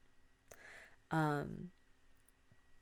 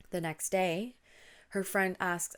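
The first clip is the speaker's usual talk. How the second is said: ordinary delivery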